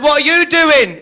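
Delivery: high, loud mock-shocked cry; shocked shout. Comic shouting at a naked man in Glasgow: shocked, high, loud cry. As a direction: yell it at a naked stranger.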